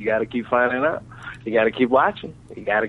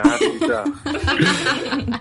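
much higher and slow through a laugh; 14 semitones higher, much slower than usual. Real, not acted. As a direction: let the grin break into the words. Asked if he is smiling.

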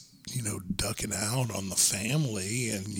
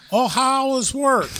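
much higher, slow, loud Minnesota mom; Minnesota accent; 13 semitones higher, 8 dB louder, slower than usual. Retold or imitated. Narrator retells mother-in-law's greeting, high slow Minnesota accent. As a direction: stretch it out like a sing-song Fargo mom. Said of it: "I love the fucking accent".

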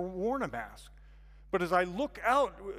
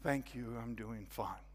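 softer, lower, resigned; defeated quiet reply; 7 semitones lower, 7 dB quieter than usual. Retold or imitated. Newcomer masking his struggles to the hostess, quietly defeated. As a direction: give in and say it small. I find myself saying.

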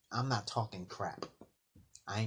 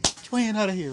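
tough brother; 11 semitones higher, 8 dB louder than usual. Retold or imitated. Brother voiced in a story.

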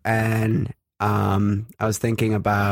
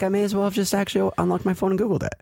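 exasperated; 10 semitones higher, faster than usual. Retold or imitated. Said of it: "I'm like".

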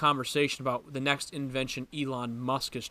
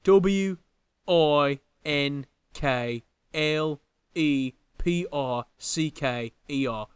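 slow, deliberate letter-by-letter spelling; spelled out letter by letter; 4 dB louder, much slower than usual. Shown as a demonstration. Spelling-bee contestant slowly spelling out the word. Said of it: "Did I get it right?".

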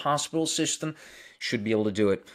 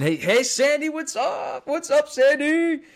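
squeaky high, drawn-out hello; a bright, high-pitched sing-song welcome; excited high greeting; 6 dB louder, slower than usual. Real, not acted. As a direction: greet a friend you're thrilled to see. Greeting a viewer by name.

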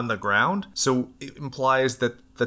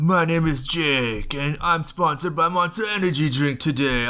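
mock ad read; 5 semitones higher than usual. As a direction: play it like a cheesy sponsored athlete. Mock sponsored athlete intro.

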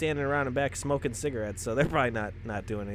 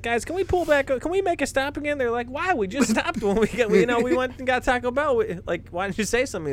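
imitating friends; 10 semitones higher, 4 dB louder than usual. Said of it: "he's like".

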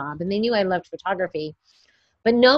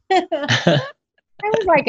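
laughing; 11 semitones higher, much slower than usual. Real, not acted. Laughing at mom's story.

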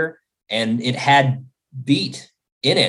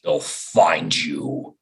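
ominous slow voice; 10 semitones lower, 4 dB quieter, much slower than usual. Acted. Improv creepy reply.

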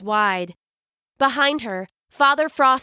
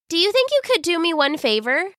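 put-on young girl voice; 8 semitones higher, faster than usual. Silva asks a favor.

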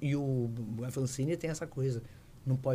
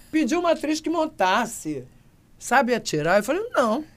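exasperated director; 10 semitones higher, 6 dB louder than usual. Retold or imitated. Exasperated director, actress can't ride.

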